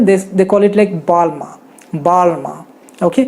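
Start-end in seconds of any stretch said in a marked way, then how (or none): 0.9-2.6 बालमा with a long, drawn "baa"; slightly different pronunciation. Shown as a demonstration. Host showing how the French brand is said, careful pronunciation. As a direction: stretch the first vowel of बालमा, lower voice. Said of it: "थोड़ा सा अलग तरीके से कहते हैं".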